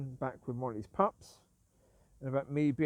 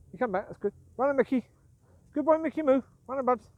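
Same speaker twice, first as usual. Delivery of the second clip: higher, louder dog-praise voice; praising the dogs; 7 semitones higher, 5 dB louder than usual. Real, not acted. Owner to dogs coming back at the whistle, higher praising call.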